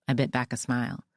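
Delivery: ordinary delivery, nothing marked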